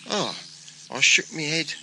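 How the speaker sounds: plain reading voice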